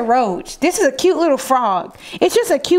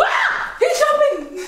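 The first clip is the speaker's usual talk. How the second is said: high yelp of fright; jumpy startled scream; startled shriek; 13 semitones higher, slower than usual. Real, not acted. Frog jumps at him.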